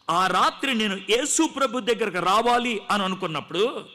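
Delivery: usual speaking voice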